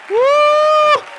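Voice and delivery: high, ringing shouted woo; worship whoop. Real, not acted. Preacher whooping to the church in a joyful cry.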